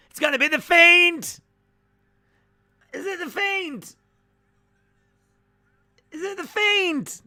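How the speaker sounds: frantic excited squeal. Real, not acted. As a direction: shriek with hype at the surprise entrance. Lights out, guessing the entrant.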